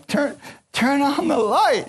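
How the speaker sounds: high, slow, squealing plea from the toes; squeaky toes voice. Pretend. Toes pleading with the sleepy walker, high squeal. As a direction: voice your stubbed toes begging, high and drawn out. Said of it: "your toes are saying".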